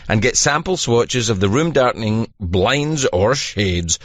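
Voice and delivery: low, steady sponsor read. Acted. Host reading a blinds ad to listeners, steady. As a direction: sell it like a radio spot.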